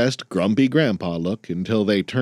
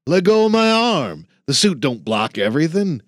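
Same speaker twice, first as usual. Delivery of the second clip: put-on sharp, higher grumpy old-man snap; grumbling; 7 semitones higher than usual. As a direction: bark at the kid to let go. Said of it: "he grumbled at the younger man".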